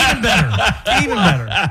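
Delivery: amused, laughing through the words. Real, not acted. Host laughing at surprising revelation.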